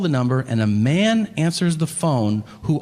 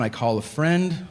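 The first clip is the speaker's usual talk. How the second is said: ordinary delivery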